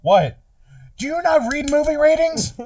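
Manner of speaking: high-pitched incredulous rant; hammed-up exasperated scolding; mock indignation. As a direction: demand it in a high, outraged voice. Acted scolding, continuing the bit.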